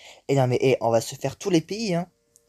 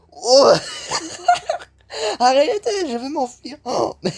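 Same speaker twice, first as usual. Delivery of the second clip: high pitched shrieking laughter; hysterical laughing; 13 semitones higher, slower than usual. Real, not acted. Gamer overwhelmed by chaos, shrieking with laughter. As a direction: crack up helplessly, begging it to stop.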